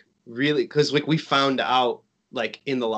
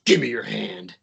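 mild gruff Vader; 3 semitones lower than usual. Retold or imitated. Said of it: "so aggressively".

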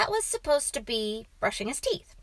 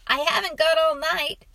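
louder, higher impatient complaint; impatient parent; 7 semitones higher, 6 dB louder than usual. A narrator's reading. Father hurrying his hiding son to bed, impatient. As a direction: press a dawdling child in a raised voice.

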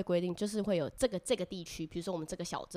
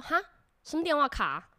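mocking Beijing accent; 8 semitones higher, 5 dB louder than usual. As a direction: imitate the exchange student from Beijing, slightly higher. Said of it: "你学得好像".

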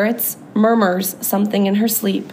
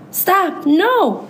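frantic cry; 9 semitones higher than usual. A narrator's reading. Ruby cries out from a bad dream, frantic. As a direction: cry out high in panic, volume held in. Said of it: "Ruby's frantic cries startle me".

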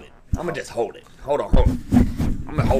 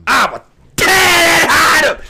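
high, loud cracking threat; hammed-up rage at being liked less than a friend; furious shout; 12 dB louder than usual. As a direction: scream it like you've lost it. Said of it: "I'm getting mad".

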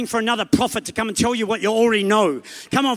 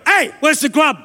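louder, higher breezy call; casual demand; 5 semitones higher, 5 dB louder than usual. Retold or imitated. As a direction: kids yelling for the grub. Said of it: "My kids go in and go".

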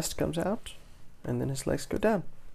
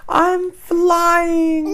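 very high, loud exclamation; giddy flying fish; excited cry; 12 dB louder than usual. A narrator's reading. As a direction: shout it high with elation. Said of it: "she cries".

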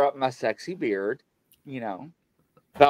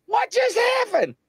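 retold frightened outburst, pitched way up; panicked shout. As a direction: yell in alarm at the sudden yank. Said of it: "And Mike was like".

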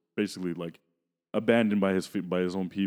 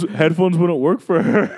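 much higher, louder, through laughter; high laughing protest; laughing disbelief; 10 semitones higher, 9 dB louder than usual. Real, not acted. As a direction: tease your friend while cracking up. Teasing friend's silly mistake.

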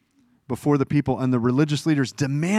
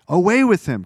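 the crowd's chant again, louder and higher; mock crowd shout; 6 semitones higher, 6 dB louder than usual. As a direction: let the mob's demand swell. Crowd cry repeated, escalating.

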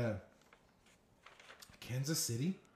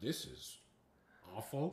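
muttered inner aside; 13 dB quieter than usual. Retold or imitated. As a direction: imitate the announcer's private thought. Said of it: "While he's apologizing, he's like".

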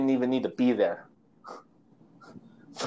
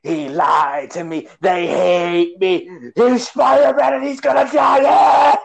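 Electro inner monologue; slower than usual. Pretend. Podcaster parodying the villain's monologue, slow then shouting. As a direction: ham up a sulking villain who snaps at the end. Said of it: "Electro's, you know, inner monologue".

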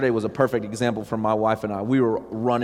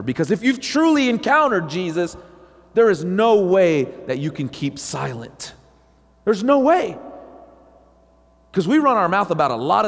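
louder, higher preaching climax; fiery preaching; 6 semitones higher, 3 dB louder than usual. Real, not acted. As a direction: hit it home like a revival preacher. Preaching at full force.

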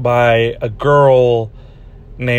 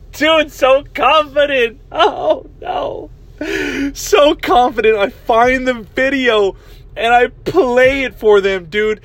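high squeaky voice, breaking up with laughter; helpless laughter; 11 semitones higher than usual. Real, not acted. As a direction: push the story out through a real laughing fit. Laughing at own mistaken confidence.

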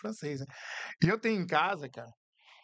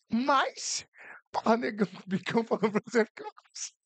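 mock indignant reply; 8 semitones higher, 3 dB louder than usual.

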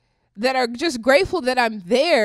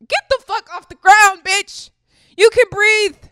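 much higher and louder, furious; angry shout; 10 semitones higher, 10 dB louder than usual. Real, not acted. Telling the mocked protester to get up, furious shout. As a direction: yell it down at them.

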